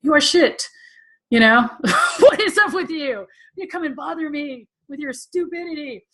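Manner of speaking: high, loud mocking scold with a laugh; imitating a contemptuous master; harsh old-school teacher. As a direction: tear the student down with scorn. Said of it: "He was more like".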